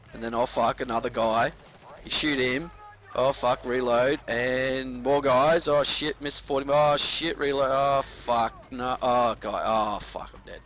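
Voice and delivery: panicked firefight. Retold or imitated. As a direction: gasp out each curse as the clip runs dry.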